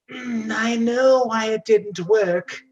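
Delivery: slow, high knowing hag. Acted. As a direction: mull it over like a wise old crone. Hag reflects on failed kiss.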